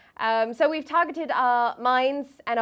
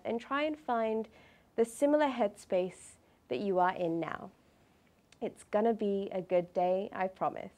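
calm meditative tone; 6 dB quieter than usual. Acted. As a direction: count the breaths gently and evenly. Leads a breathing meditation.

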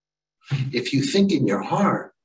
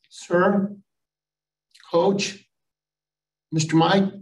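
put-on higher, halting kid voice; little boy; 5 semitones higher, slower than usual. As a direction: imitate a small child calling timidly, with pauses. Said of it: "then the littlest guy".

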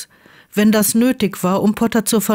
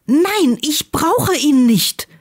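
higher and slowed, an angry refusal; angry shout; 7 semitones higher, slower than usual. A narrator's reading. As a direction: refuse him hotly, pitched up. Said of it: "schrie Draco wütend".

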